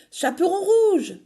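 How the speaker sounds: high loud call; shout. Acted. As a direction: call out to warn her. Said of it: "crie-t-elle".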